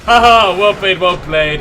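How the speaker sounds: loud mock laugh, drawn-out words; loud, theatrical concession; hammed-up good-sport quote. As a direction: read it out big and slow, imitating a gracious loser. Reads Barbarossa's chat message aloud.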